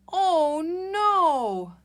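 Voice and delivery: high, loud cry of alarm; alarmed Rosie. Acted. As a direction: gasp it out as if something went wrong. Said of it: "it says".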